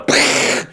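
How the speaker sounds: unvoiced lip puff for a blast; pfft explosion noise. City blowing up, mock pfft explosion sound. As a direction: puff air through the lips like a tiny explosion. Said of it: "it ends with a".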